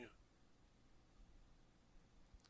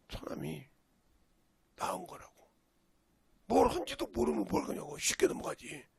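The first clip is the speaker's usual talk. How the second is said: ordinary talk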